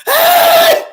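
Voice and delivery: piercing high scream; mock shriek. Mocking the frightened woman, piercing scream. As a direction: let out a shrill fake scream.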